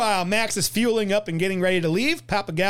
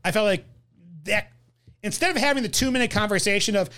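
usual speaking voice

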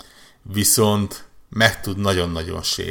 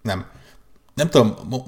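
normal talking voice

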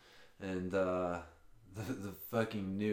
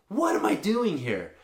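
higher, louder disbelieving cry; exasperated; 8 semitones higher, 5 dB louder than usual. Real, not acted. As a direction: throw up your hands at the whole job. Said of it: "I'm just sitting here like".

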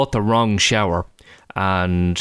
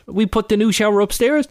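imitating toddler; 10 semitones higher than usual. Voicing his toddler son.

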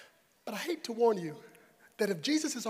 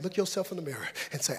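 ordinary talk, nothing marked

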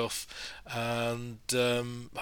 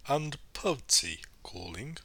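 put-on posh BBC English accent; 3 dB quieter than usual. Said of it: "I have given off my northern tribe accent".

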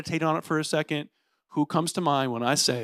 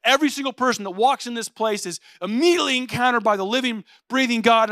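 higher-pitched, zealous pep talk; eager church member; 6 semitones higher, 3 dB louder than usual. Acted. Attractional church people rallying the host team, eagerly. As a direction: voice a keen host team volunteer. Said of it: "They're like".

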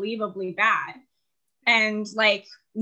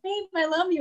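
high, sweet lovey boyfriend impression; mock-sweet babe voice, much higher; 9 semitones higher than usual. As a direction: coo it like a doting boyfriend. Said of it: "he's like".

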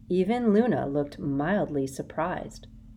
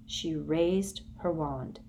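usual speaking voice

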